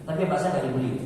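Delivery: normal talking voice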